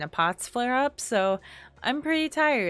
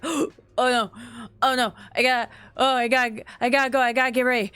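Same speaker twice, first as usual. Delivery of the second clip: retold panic on waking late, a touch louder; mock frantic rush, slightly louder; panicked; 4 semitones higher, 5 dB louder, faster than usual. Streamer retelling waking up late, mild mock panic.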